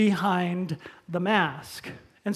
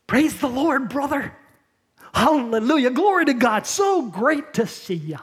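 syrupy, higher, overly sweet church greeting; creepy smiley churchgoer; 4 semitones higher, 4 dB louder than usual. Retold or imitated. Preacher imitates a fake-smiling churchgoer greeting a brother, syrupy. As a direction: gush fake praise with a plastic smile. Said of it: "it might goes like this".

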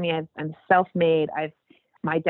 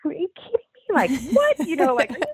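high, fast disbelieving squeal; asking God if he's serious; incredulous; 12 semitones higher than usual. Retold or imitated. Disbelieving God's call, high and fast.

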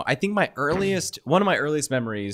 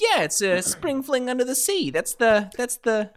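put-on high, eager old-movie teenager; sixties movie teen; 10 semitones higher than usual. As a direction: play a keen fifties teen.

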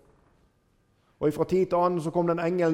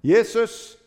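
a higher, louder cry of the name; calling out; 6 semitones higher, 5 dB louder than usual. Retold or imitated. Blind man calling to Jesus passing by, loud cry. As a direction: call out to someone passing. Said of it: "Og så roper han".

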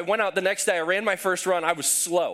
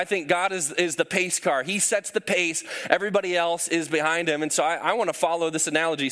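ordinary talk, nothing marked

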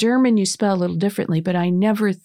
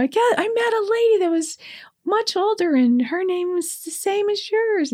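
excited; 11 semitones higher than usual. Retold or imitated. Telling granddaughter about the lady.

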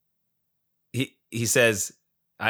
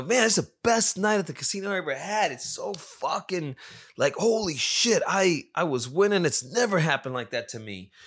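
imitating a thrilled friend, pitched up; excited retelling; 7 semitones higher than usual. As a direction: gush like a buddy after a big win. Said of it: "I'm fucking so excited".

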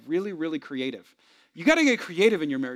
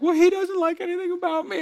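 high-pitched mock wail; imitated tearful wife; 11 semitones higher than usual. Wife complaining about her husband to the pastor, mock-tearful high wail. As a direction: put on a hammed-up tearful whine. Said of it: "in tears sobbing".

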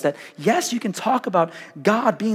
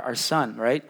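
ordinary delivery

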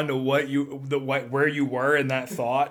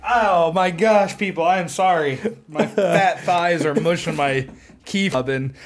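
laughing apology; 6 semitones higher, 6 dB louder than usual. Real, not acted.

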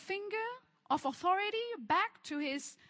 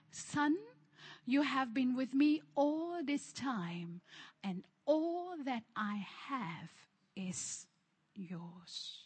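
tender reassuring father; 3 semitones lower, slower than usual. Acted. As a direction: reassure a hurt son gently, word by word. Father gently reassures resentful elder son.

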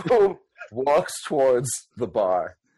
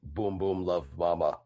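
put-on low, quiet childlike brute; Boom Boom voice; 7 semitones lower, 9 dB quieter than usual. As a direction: rumble it softly like a big simple giant. Boom Boom answers his mother.

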